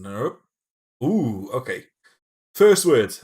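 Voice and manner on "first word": loud announcement. Real, not acted. Announcing first word loudly.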